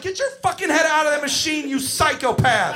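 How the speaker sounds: high, rapid scolding, slowing on the insult; exasperated yelling. Retold or imitated. Counsellor to kid in ball return, exasperated yelling. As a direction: rush it out in alarm, then stretch the name-calling.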